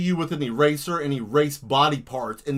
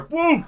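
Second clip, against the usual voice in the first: boom sound; 12 semitones higher, 6 dB louder than usual. Acted. Explosion sound effect.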